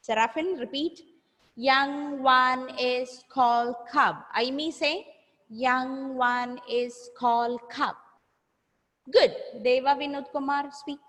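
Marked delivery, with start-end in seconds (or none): 1.6-4.2 very slow teacher modelling; slow repeat-after-me. Shown as a demonstration. Model sentence for pupils to repeat. 5.5-8.0 slow, evenly spaced teacher modelling; slow repeat-after-me. Shown as a demonstration. Model sentence for pupils to repeat.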